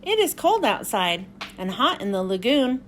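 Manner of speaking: usual speaking voice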